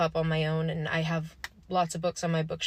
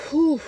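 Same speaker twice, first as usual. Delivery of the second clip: loud, high "whew" exhale; overwhelmed whew; 9 semitones higher, 8 dB louder than usual. Real, not acted. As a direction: blow out a heavy, overwhelmed breath. Overwhelmed exhale at what she read.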